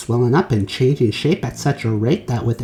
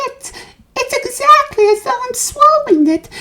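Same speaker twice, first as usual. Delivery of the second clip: put-on rapturous girl; 4 dB louder than usual. A narrator's reading. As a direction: savor every word like a greedy child. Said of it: "shouted Violet".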